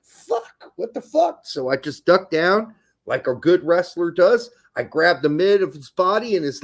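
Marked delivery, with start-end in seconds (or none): 0.0-1.4 high-pitched startled cry, acted out; retold alarmed shock. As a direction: imitate yourself caught off guard, pitch leaping up. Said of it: "And I'm like".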